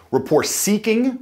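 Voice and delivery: Rapport seeking with a lift at the end; rising rapport-seeking tune. Shown as a demonstration. Coach previews the rapport-seeking tune with a lift. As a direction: let Rapport seeking curl upward. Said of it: "ends with a twirl up at the end".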